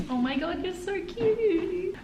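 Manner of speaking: high, slow, soft coo. Real, not acted. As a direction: gush over a cute puppy. Cooing at puppy.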